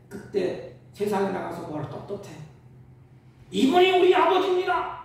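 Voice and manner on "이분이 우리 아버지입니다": high, loud declaration; ringing cry of pride; proud proclamation. Acted. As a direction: announce it proudly to everyone. Voices a proud child declaring.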